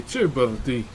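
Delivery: ordinary delivery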